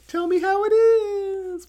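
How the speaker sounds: very high slow falsetto line; mock falsetto. Friend joins the joke, slow high falsetto. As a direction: stretch it out in a silly high voice.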